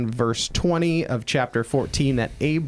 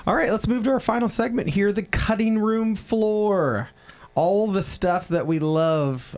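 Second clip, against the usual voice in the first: segment announcer; 9 semitones higher than usual. Acted. Announces final podcast segment.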